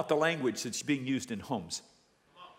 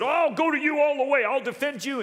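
high, loud eager vow; bold pledge; 9 semitones higher, 6 dB louder than usual. Acted. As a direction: promise it with overblown zeal, pitched up. Peter's bold pledge, acted out.

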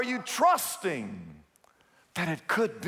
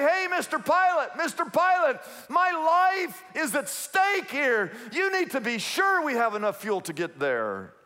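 urgent protest; 6 semitones higher than usual. Acted. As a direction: plead emphatically with the pilot, pitch starting high. Passenger protesting to a careless pilot.

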